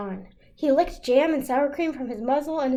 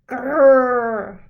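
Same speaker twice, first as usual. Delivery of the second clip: bear growl; 7 dB louder than usual. A narrator's reading.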